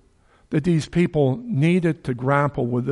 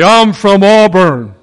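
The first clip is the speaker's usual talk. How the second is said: boastful shout; 5 semitones higher, 11 dB louder than usual. Retold or imitated. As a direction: brag it out to a hostile crowd. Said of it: "and you say".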